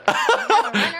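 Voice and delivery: sudden high laugh; high burst of laughter. Real, not acted. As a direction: crack up at the bad throw. Laughing at the throw.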